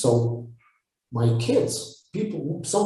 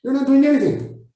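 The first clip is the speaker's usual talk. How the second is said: much higher, blunt telling-off; blunt scolding; 11 semitones higher, 3 dB louder than usual. Retold or imitated. Retelling how he scolds complainers, high and blunt. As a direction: call them out straight, pitched way up. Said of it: "I said".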